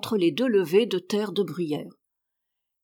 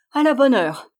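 louder, higher satisfied exclamation; raised, decisive approval; emphatic approval; 5 semitones higher, 6 dB louder than usual. A narrator's reading. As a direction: approve firmly, voice lifted. Old woman approves the miller giving in.

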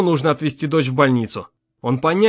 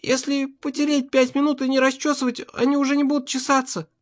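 маленькая дочь; 11 semitones higher than usual. Acted. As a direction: recite daddy's advice like a small child. Дочь повторяет папин совет. (высокий детский голосок)

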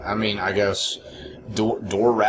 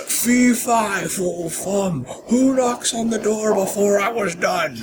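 high, slow hammed-up giant chant; mock giant; 9 semitones higher, slower than usual. Imagining the huge guy as an angry giant, hammed-up storybook giant. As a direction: boom it out like a cranky storybook giant. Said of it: "who, you know".

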